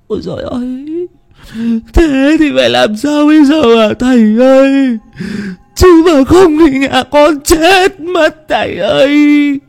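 very high-pitched, whimpering village wife; tearful wailing. A narrator's reading. Wife begs the shaman to save her household, tearful wailing. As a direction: wail it high, drawing out the last plea. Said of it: "Thím ú ớ mếu máo".

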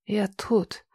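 faint, soft croak; hoarse reply. Acted. Heroine weakly answering Marcus, soft croak. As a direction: barely get the words out. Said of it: "прохрипела я".

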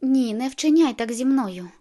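loud, drawn-out refusal; protesting plea. A narrator's reading. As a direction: push back hard, stretching the words. Narrated character protests at pressure.

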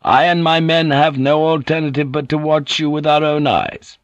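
firm samurai stating his orders. A narrator's reading. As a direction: hold your ground formally. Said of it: "this samurai said firmly".

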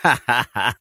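short smug acted laugh; self-satisfied chuckle in character; conceited laugh. A narrator's reading. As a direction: laugh like a vain boss. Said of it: "ضحك في غرور".

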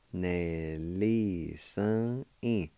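Nelly sun in drawn out very slowly, each syllable held; slow demo. Shown as a demonstration. Teacher slowing Nelly sun in for students to follow. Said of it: "And it's pronounced like this".

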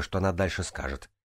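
ordinary delivery, nothing marked

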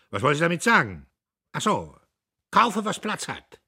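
high, loud, curt officer; loud high lieutenant voice; clipped officer voice. A narrator's reading. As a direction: bark it like a brash lieutenant. Premierleutnant voice.

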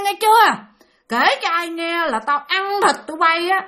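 put-on high, forceful threatening old woman; menacing, pressed voice. Woman warns children to tell nobody, pressing menacingly. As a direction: bear down on each warning. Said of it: "Mà bà nói dằn dằn cái giọng nha".

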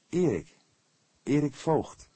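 put-on cheerful young man. A narrator's reading. Intruder gives his name.

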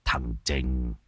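low, soft, heavy-hearted; name his son with a sigh in the voice; sad. A narrator's reading. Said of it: "Lão buồn bã". (ông lão buồn rầu nhắc tên con)

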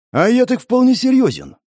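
high-pitched, insistent retort; insist, voice lifted well up; emphatically serious. A narrator's reading. Man answering his boss's joke, insistent.

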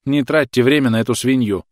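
loud, cold scorn; icy contempt. A narrator's reading. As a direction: spit it with icy disdain. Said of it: "ледяной голос мадам Дюмулен".

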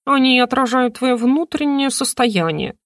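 lightly voiced talking bird. Bird explaining to the heroine, lightly voiced and knowing. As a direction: lecture like a wise little bird. Хрумса speaks.

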